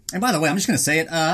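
normal talking voice

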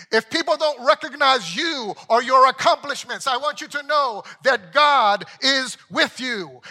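loud, high, rising sermon climax; preaching at full force. Acted. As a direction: preach it out, building with every repeat.

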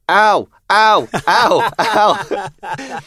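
high, loud mock ow; a toddler copying her sister's cry; fake toddler ouch. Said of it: "Georgia just went".

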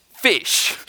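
gleeful punchline. Real, not acted. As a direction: land the joke with real glee. Punchline, speaker laughing at the story.

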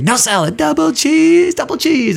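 very high, drawn-out counterman holler; imitated yelling. Cook refusing woman's salad order, mock yelling. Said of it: "he starts yelling at her".

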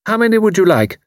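a curt hostess question; brisk, pointed offer; crisp demand. A narrator's reading. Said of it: "she demanded crisply".

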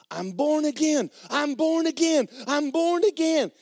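high, loud imitated screaming. Man runs out of his door to maintenance man, screaming.

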